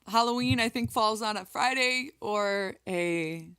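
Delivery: ordinary talk, nothing marked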